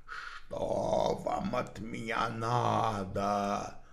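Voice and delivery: slow, low, otherworldly growl; low muffled alien voice. Acted. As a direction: growl it like a spirit speaking through someone. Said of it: "прорычал низким, глухим, совершенно чужим голосом".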